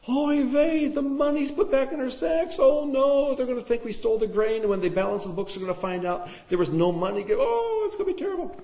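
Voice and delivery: high, fretful voice of panicking brothers; worried brothers. Acted. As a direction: wring your hands and fret out loud.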